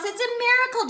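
bright rising exclaim of joy; excited exclaim. A narrator's reading. Joseph tells friends of the miracle, excited. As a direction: burst out with wonder. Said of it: "Joseph exclaimed".

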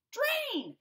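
"drain" burst out high and loud; sudden shout. Acted. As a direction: explode on "drain". Fish chants drain.

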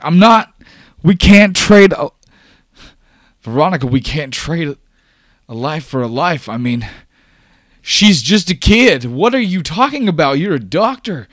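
loud, high frantic pleading; stumble, then shout in outraged appeal; losing his mind. Acted. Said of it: "as he's just kinda, like, losing his mind".

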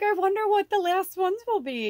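bubbling over with curiosity; mock childlike wonder up high; excited wondering. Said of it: "I'm like".

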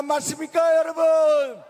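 rally cry, rising to a shout; rousing shout. Real, not acted. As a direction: shout it to the crowd. Rally call to crowd.

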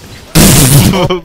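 big, loud burst of laughter. Real, not acted. Player reacting to a play, loud laugh. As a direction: laugh out hard.

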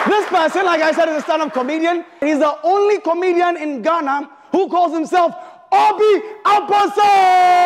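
building to a loud, high hype call; hype announcer. Acted. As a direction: announce her like a stage MC. Host builds up next contestant.

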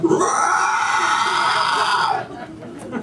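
put-on high shrieking wail of a woken child; mock toddler screech. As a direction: screech like a grumpy little Sasquatch. Child roused at five a.m.

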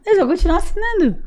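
high-pitched playful put-on voice; mock subscriber reply. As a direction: pipe it up in a teasing mock protest.

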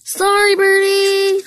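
loud apology. A narrator's reading. Engine apologising in story.

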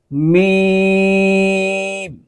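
मीम drawn out slowly with no sound through the nose; clear, no nasal. Shown as a demonstration. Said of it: "तो इसको यूं पढ़ेंगे". (मीम धीरे खींचकर, बिना गुन्ना के)

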